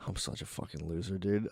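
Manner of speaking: low, hushed muttering to himself; self-loathing mutter. Retold or imitated. New father in scrubs berating himself, low hushed mutter. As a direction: mutter it under your breath, low. Retelling his own self-talk.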